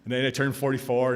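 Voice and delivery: usual speaking voice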